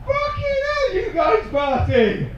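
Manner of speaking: high, slow, amazed shout; excited yell. Real, not acted. Comedian marvelling at the partying crowd, high excited yell. Comic reacting to rowdy crowd.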